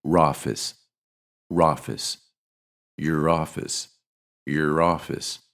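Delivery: "roffice" with the r of your starting office; "Your office" as "yer roffice"; linked r. Shown as a demonstration. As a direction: carry the r into "office". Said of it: "we say".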